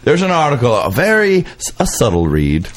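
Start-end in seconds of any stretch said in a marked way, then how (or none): none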